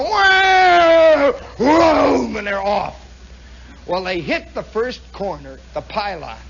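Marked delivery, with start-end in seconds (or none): 0.0-2.4 loud, high engine roar; long falling howls of planes at the start; airplanes revving off. Retold or imitated. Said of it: "these airplanes go".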